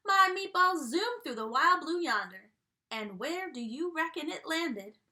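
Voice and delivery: drawling cowboy storyteller voice; a jolly country restaurant owner spinning his yarn; folksy dog chef. Acted. Reading to kids as Yodeler Jones, folksy country voice.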